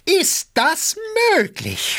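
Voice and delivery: high-pitched outburst of disbelief; enraged cry. A narrator's reading. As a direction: cry out, beside yourself. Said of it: "schrie Gaganow". (wütend aufschreiend)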